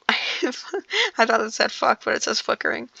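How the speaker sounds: whispered, slow giggling aside; laughing. Real, not acted. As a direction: laugh through the words. Laughing at her own misreading.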